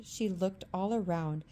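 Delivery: ordinary delivery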